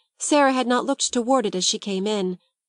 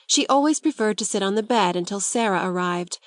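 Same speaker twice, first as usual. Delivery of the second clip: plain reading voice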